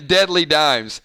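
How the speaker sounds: usual speaking voice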